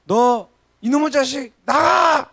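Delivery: slow build into a high shouted get out; angry mother shouting. Retold or imitated. Mother shouting at her son to leave. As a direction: bark it like a fed-up mom. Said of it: "우리 엄마가, 저희 엄마가".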